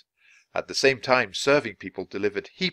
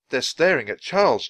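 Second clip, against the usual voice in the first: mouth full; 5 semitones higher, 6 dB louder than usual. A narrator's reading. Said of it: "Jack mumbled, his mouth full".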